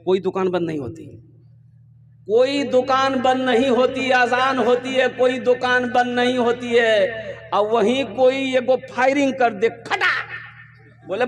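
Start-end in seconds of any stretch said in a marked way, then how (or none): 2.2-9.7 higher, sustained declaiming tone; insistent sermon repetition; raised preaching cadence. Real, not acted. 9.7-10.1 sudden high gunshot noise; abrupt spoken bang; imitated gunshot.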